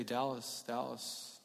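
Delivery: very soft, intimate naming; as if God whispers your name; tender call. Acted. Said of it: "I would even say".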